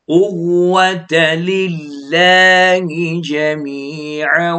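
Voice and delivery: slow, drawn-out chanted recitation; Quran recitation. Shown as a demonstration. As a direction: recite it slowly with tajweed. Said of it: "อ่านเน้น". (อ่านทำนองกุรอานช้าๆ)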